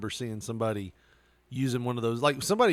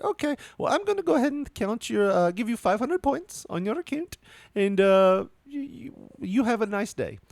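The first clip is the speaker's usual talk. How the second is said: much higher, sing-song customer-service voice; chirpy hotel manager; 10 semitones higher than usual. Retold or imitated. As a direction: imitate a sugary sales manager greeting a guest. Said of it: "That's the way she talked".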